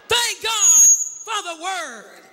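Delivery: loud ringing shout, drawn out slowly; shouted praise. Shown as a demonstration. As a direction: belt it to the whole church. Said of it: "Will you tell somebody else".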